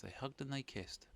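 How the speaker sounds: ordinary delivery, nothing marked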